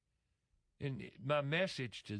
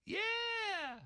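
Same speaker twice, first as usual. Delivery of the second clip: much higher, beaming yeah; happy worker's yes. Retold or imitated. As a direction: imitate a delighted worker answering. Voicing a happy blind worker.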